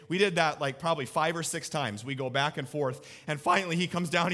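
normal talking voice

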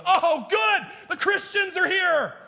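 sarcastic partygoer dreading arrival; sarcastic partygoer at a wild party, dreading the Christians' arrival. Pretend.

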